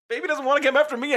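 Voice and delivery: high, fast put-on Henry voice. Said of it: "I have to do a Henry voice".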